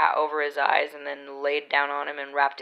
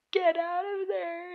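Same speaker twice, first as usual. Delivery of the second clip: high, drawn-out retold plea; urgent pleading; slower than usual. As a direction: coax a puppy desperately, very high and slow. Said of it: "trying to speak with the most persuasion possible".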